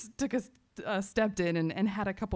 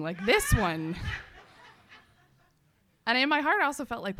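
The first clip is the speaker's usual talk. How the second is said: ordinary talk, nothing marked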